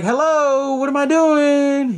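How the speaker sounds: high, drawn-out taunting call; mock challenge. Sidney calls the unseen caller's bluff, high drawn-out taunt. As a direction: sing out a slow, high challenge.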